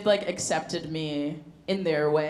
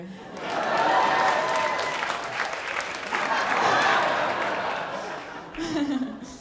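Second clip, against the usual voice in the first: long laugh; 7 semitones higher than usual. Real, not acted. Crowd laughs at bit.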